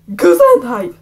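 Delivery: loud shout; shouted gesundheit. Acted. Classmate shouts across the room, loud. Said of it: "someone shouted".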